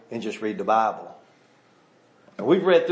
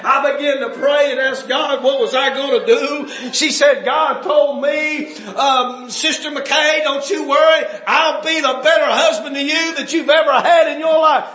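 high, loud revival-preacher shout; full-force preaching; 11 semitones higher, 7 dB louder than usual. Acted. Preacher retelling a widow's testimony to the congregation, full-force preaching. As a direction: drive it out high and loud from the pulpit.

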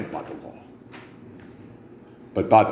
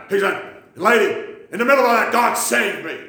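high raspy addict voice, twitchy; old crackhead telling his story; 11 semitones higher than usual. Retold or imitated. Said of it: "he had that old crackhead voice".

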